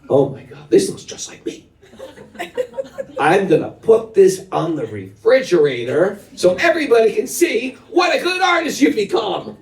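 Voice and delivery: rising to a high shout of praise; proud dad. Retold or imitated. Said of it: "A good dad says".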